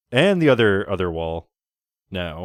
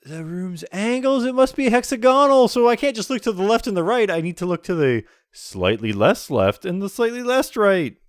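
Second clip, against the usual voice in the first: high dramatic narrator; 8 semitones higher than usual. Pretend. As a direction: ham up the puzzling narrator.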